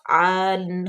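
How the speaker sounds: usual speaking voice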